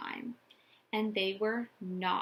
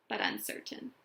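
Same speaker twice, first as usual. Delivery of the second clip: usual speaking voice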